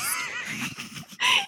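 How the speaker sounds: airy squeal of laughter; breathy high laugh. Real, not acted. Laughs at Jasper's request.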